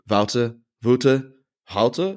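tentative tries at Wouter, slowly varying the vowel: VOW-ter, VOO-ter; trying out pronunciation. Shown as a demonstration. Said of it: "How close was I with the pronunciation".

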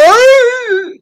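high piercing shriek of fear; panicked scream. Retold or imitated. At the top of the Ferris wheel, a panicked shriek. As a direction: scream like the ride is falling. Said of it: "I'm like".

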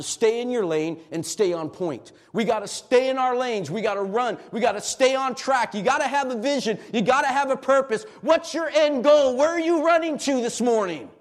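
loud, high preaching that builds to a shout; rally the congregation, climbing to a roar; full-throated sermon climax; preaching at full force. Acted.

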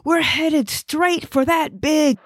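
high, slow scream of alarm; terrified warning. Acted. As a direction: stretch it out high as the crash looms. Passenger sees crash coming.

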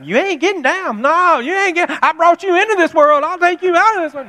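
a mother laying down the law; furious mom. Retold or imitated. Mom threatening the stubborn toddler, loud and high. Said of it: "Mom's over there".

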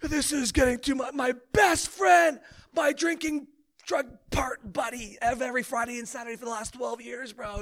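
high-pitched, hammed-up anguish; mock breakdown, stretching the words out in a cry; super emotional. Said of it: "he's getting super emotional".

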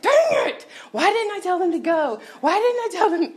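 loud, high-pitched mock tantrum; hammed-up yell of self-blame; frustrated outburst. Speaker re-enacts her outburst after the bad phone call, loud frustration.

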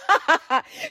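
a quick real laugh between lines; short laugh. Real, not acted. Preacher laughing at the neighbor's reveal, short laugh. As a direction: break into a brief laugh.

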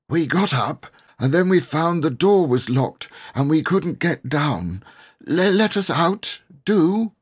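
put-on a frightened boy begging to be let out; pleading boy. A narrator's reading. Cyril begs the keeper through the locked door, urgent and high.

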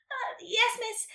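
high little chirp. A narrator's reading. Karen answering her teacher, chirping. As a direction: chirp it up high like an obedient pupil. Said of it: "Karen chirped".

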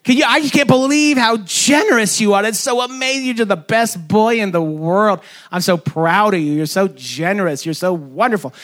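high, excited, gushing praise; gushing mom. Retold or imitated. Mom praising her little boy's gift, high and gushing. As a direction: heap on the praise like a proud mom. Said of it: "the mom is like".

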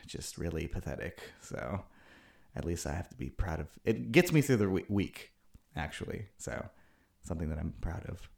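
quiet. Real, not acted. Self-pitying quiet aside.

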